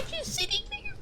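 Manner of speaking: high squeaky surprised voice, slowing at the end; surprised squeal. Real, not acted. Streamer spots a box she missed, squeaky and amazed. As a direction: squeak in disbelief at the missed item.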